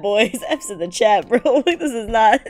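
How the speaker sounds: usual speaking voice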